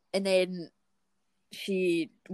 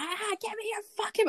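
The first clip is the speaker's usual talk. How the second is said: mild mock bellow; 13 semitones higher, 4 dB quieter than usual. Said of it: "actual bellowing".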